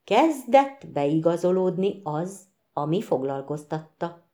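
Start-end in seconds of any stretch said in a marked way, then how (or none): none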